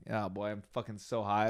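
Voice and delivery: soft, low aside to himself; low-voiced stoned "oh boy"; quiet inner dread. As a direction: think it under your breath. Stoned inner thought on meeting boss.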